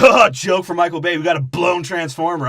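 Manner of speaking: loud, high hammed-up gag delivery; mocking impression. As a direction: blurt out the lame joke, mocking it. Mocks movie character's forced joke.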